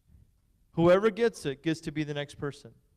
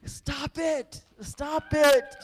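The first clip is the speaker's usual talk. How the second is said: higher, playful fake stop; mock protest; 8 semitones higher, much slower than usual. Pastor cueing the crowd to cheer, mock protest. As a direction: pretend to beg them to stop. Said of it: "When I say come on, that means more".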